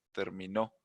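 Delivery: "Terminó" lifted at the final "nó"; teacher's example of "Terminó", accented on "nó"; stress on final syllable. Shown as a demonstration. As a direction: let "Terminó" climb to its last syllable. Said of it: "Y sube acá".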